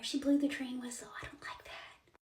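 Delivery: very soft, spooked whisper; uneasy whisper. Retold or imitated. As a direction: whisper it as if afraid of being overheard. Retells mom's scared whispered report.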